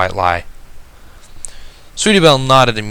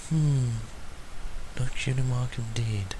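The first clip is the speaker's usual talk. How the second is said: much softer, murmured aside; muttered to herself; 2 semitones higher, 13 dB quieter than usual. Acted. Lady sizes up the stranger, muttering softly to herself. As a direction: mutter it under your breath. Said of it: "she muttered, mostly to herself".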